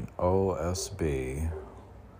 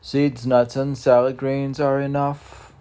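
higher, louder pronouncement; proclaiming; 8 semitones higher, 8 dB louder than usual. Acted. Laying down his diet rule, loud and high. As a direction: announce it as a rule.

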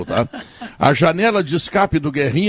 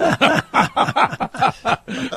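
laughing; 8 semitones higher than usual. Real, not acted. Host laughing at own joke.

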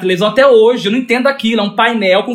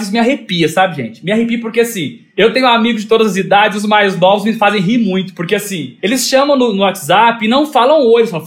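ordinary talk

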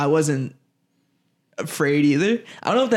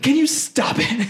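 put-on exasperated girlfriend; 10 semitones higher than usual. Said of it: "She's like".

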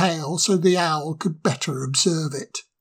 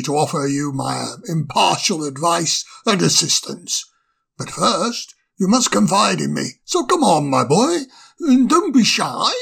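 put-on beam at him like a kindly old professor; cheerful old owl. Owl cheerfully offers the sprite his help. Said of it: "said the owl cheerfully".

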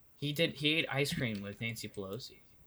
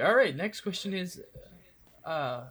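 stammering quiz host; 2 semitones higher than usual. Retold or imitated. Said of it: "I have a hard time reading".

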